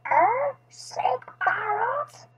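high, slow parrot mimicry; squawky parrot repeating a question; parrot echo. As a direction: echo it like a croaky pet parrot. Parrot seems to echo the vet.

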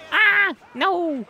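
high, sudden cry of dismay; real startled outburst; startled yell. Real, not acted. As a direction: yelp out in alarm at the game. Cries out at game mishap.

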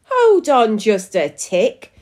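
lightly squeaked protest with a slow pull; mild squeak. A narrator's reading. Missus Prune disputes the tie, a mild squeak. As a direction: object with a small squeak, drawn out. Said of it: "squeaked Missus Prune".

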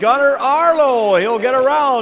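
high, rising play-by-play; excited race call. Real, not acted. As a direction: build up the drag race.

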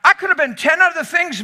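loud, fast, laughing; preacher overwhelmed by the story; excited fast preaching. Real, not acted. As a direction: rush it with delight. Excited sermon climax.

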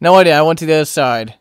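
ordinary delivery

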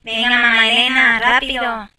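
ordinary talk

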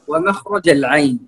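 ordinary talk

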